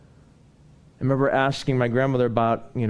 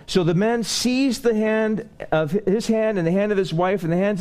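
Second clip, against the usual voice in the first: full-force preaching; 8 semitones higher than usual. Acted. Preacher urging the congregation not to linger, fervent. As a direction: drive the sermon home to the congregation.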